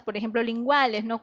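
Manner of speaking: ordinary delivery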